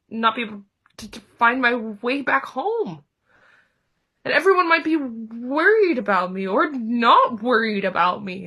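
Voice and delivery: Piglet stuttering on word starts, growing flustered; anxious stammering. Acted. Piglet imagines being alone in the woods, anxious stammer. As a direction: stumble into worry, then break off helplessly.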